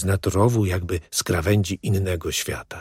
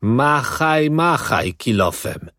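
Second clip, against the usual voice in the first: urgent warning, first word pitched up; raised first word, then drawn-out caution; 5 semitones higher, slower than usual. A narrator's reading. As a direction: warn a workmate to keep digging. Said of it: "rzekłem ostrzegawczo".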